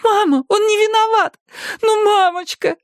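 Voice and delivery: put-on high, loud childlike plea; pleading little girl. A narrator's reading. Girl begging her mother to believe Erik, high loud plea. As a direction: beg her mother desperately.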